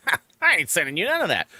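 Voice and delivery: high, quick scoff; scoffing refusal. Retold or imitated. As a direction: laugh it off and refuse. Scoffing at a suspicious request.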